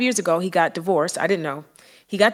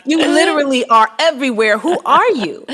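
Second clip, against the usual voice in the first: high, loud teasing "Who are you?"; mock-incredulous, calling him out; a loud, high put-on voice; playful disbelief; 6 semitones higher, 7 dB louder than usual. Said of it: "I just was like".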